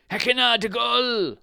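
high, loud shrill shout; shrill cry. A narrator's reading. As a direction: cry it out shrilly from a tiny man. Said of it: "he cried in a shrill voice".

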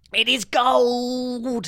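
high, drawn-out yell on gold; exultant shout. A narrator's reading. Baron finds gold in the sack, high drawn-out shout. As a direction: yell it high, stretching the last word. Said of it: "he shouted".

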